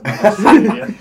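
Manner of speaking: laughing loud refusal. Real, not acted. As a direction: laugh it off loudly.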